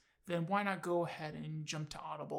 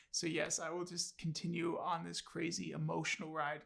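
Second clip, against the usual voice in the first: usual speaking voice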